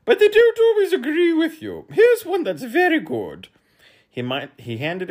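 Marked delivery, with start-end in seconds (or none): 0.0-3.5 very high, loud bug character; humbug voice. A narrator's reading. Humbug recommending a cake to the boy, very high. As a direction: explain eagerly in a very high, loud voice. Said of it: "explained the humbug".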